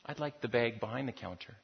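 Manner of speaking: sweet and quiet, like a mother with a surprise; smiling mother. Acted. Mother to the teller at the counter, soft and smiling. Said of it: "the mother smiles to the teller and says".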